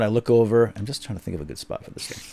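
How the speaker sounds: ordinary delivery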